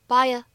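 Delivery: "buy a" joined with a slight y glide; light vowel linking. Shown as a demonstration. Teacher shows learners natural linking, "buy a" as one word. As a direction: glide gently from "buy" into "a" without a break. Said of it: "自然又轻微的一个连读".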